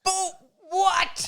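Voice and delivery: high indignant squeak; surprised. Real, not acted. Friend calls Pegasus worst character.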